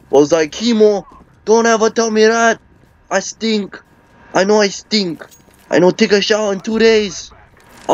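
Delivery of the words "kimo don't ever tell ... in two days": high-pitched, hurt protest with no real sobs; mock tearful. Uncle hurt at being told he stinks, mock tearful. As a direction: imitate a wounded uncle complaining. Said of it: "burst out in tears".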